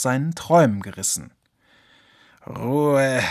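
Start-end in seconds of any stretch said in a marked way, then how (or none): 2.5-3.2 sleepy mutter. Acted. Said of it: "murmelte er".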